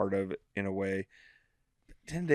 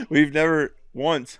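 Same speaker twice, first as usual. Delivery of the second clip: higher and louder, slow, laughing; 6 semitones higher, 7 dB louder, slower than usual. Real, not acted. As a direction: drag it out while cracking up. Joking about never promoting.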